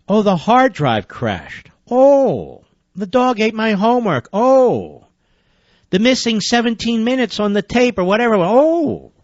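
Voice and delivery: sarcastic, with long drawn-out "Oh"s; mock the lame excuse with exaggerated dismay. Host mimics officials' lame excuses, mock dismay.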